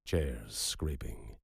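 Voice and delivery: plain reading voice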